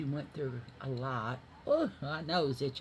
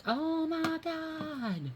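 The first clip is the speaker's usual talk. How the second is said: very high, slow, drawn-out amazement; amazed gasp; 4 dB louder, much slower than usual. Retold or imitated. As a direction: stretch it out up high in disbelief. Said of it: "she was like".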